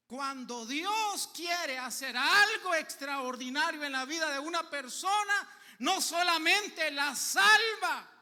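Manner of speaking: slow, loud, rising preacher's cry; preaching at full force. Acted. Preacher tells congregation what God does, at full force. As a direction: proclaim it to the whole church, slowly.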